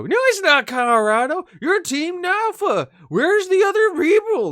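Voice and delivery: hammed-up drunk cowboy, slowing on the question; drunken Western guy. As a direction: play a tipsy old prospector, loud and high. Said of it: "He's like a drunken Western guy".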